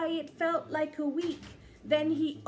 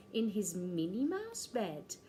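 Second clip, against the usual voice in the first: ordinary delivery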